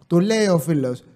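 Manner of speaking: usual speaking voice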